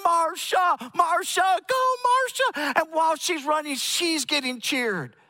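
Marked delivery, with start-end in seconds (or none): 0.0-2.8 loud high cheering chant; cheering crowd shouting a runner's name. Retold or imitated. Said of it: "they start yelling".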